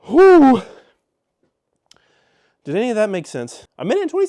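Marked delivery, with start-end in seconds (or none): 0.1-0.6 big exhale of relief, much louder; loud relieved whew. Real, not acted. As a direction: blow out air after a breathless rush. Relief after timed rambling synopsis.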